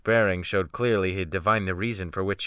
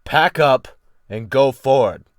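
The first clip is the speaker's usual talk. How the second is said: imperative command; 8 dB louder than usual. Acted. Captain ordering the defiant boy, loud command. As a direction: lay down the order with no room to refuse. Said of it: "command was thrillingly imperative".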